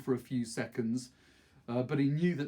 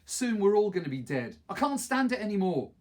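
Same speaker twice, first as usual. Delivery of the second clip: bitter despair; 7 semitones higher, 6 dB louder than usual. A narrator's reading. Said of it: "Her voice was heavy with bitterness".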